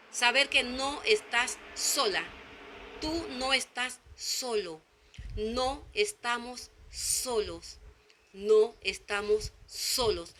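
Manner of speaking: slow, soft comfort; reassuring. Real, not acted. Preacher comforting lonely listeners, slow and soft. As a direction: say it slowly, as if laying a hand on their shoulder.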